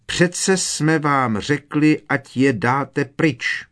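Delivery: every word a reproach to the twins; mother rages at the twins; furious mother. A narrator's reading. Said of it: "vztekala se paní Weasleyová".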